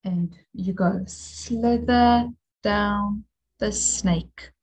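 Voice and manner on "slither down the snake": slither down the snake, slow and sing-song; rhyme for the letter. Shown as a demonstration. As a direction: draw out slither down the snake like a chant. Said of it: "say a saying like".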